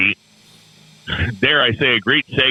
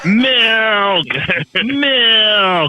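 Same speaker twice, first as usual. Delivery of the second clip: yelling across the room; 10 semitones higher, much slower than usual. Retold or imitated. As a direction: shout it to the next room, laughing. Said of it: "when I yell across the house to my niece".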